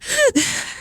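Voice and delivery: quick high chuckle; brief amused laugh; short laugh. Real, not acted. Laughing at weird comics.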